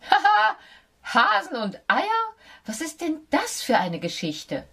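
hohes, lautes Lachen der Maus; schallend lachend. A narrator's reading. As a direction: lach schallend und ungläubig. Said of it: "Sie lachte schallend". (die Maus lacht Fox ungläubig aus)

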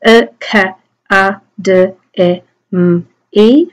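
phonemes of academy, each isolated; "E, K, A, D, E, M, E" as speech sounds; sounding out. Shown as a demonstration. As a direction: separate sounds with small gaps. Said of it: "sound them out".

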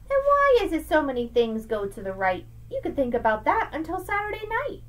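lively sing-song picture-book read; reading to children. Acted. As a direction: read Seuss aloud to a child, bouncing the rhymes. Reads Dr. Seuss aloud.

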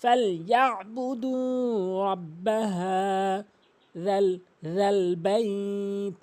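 slow sustained chant; measured Quranic recitation, long vowels; model recitation. Shown as a demonstration. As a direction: draw out রাব্বাহা and বাইত. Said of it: "উচ্চারণ করতে হবে".

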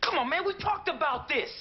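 angry, exasperated rebuke to a brother; heated argument. Acted. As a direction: argue back hard, pitch climbing. Brothers quarrel over their parents.

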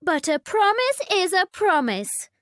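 high insistent princess voice; whiny spoiled princess; cartoon princess. A narrator's reading. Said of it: "said the princess".

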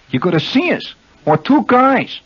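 high-pitched mock New Jersey local; New Jersey accent. Shown as a demonstration. Host demonstrates how natives name stores, high-pitched Jersey accent. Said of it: "that's the correct pronunciation".

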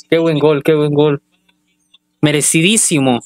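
ordinary talk, nothing marked

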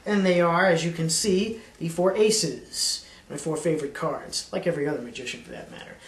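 showy magician's pitch; card magician patter. Acted. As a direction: sell the trick like a stage magician. Magician showing a card trick.

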